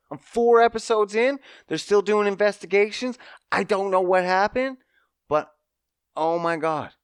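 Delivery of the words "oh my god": normal talking voice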